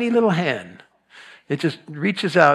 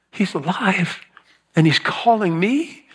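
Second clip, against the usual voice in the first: voiced aloud with a lift at the end; rising joy; 4 semitones higher than usual. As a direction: let the wonder break into the open. Mary realizing he lives.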